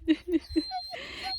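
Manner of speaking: delighted high-pitched giggle; high laugh. Real, not acted. Dog comes running up.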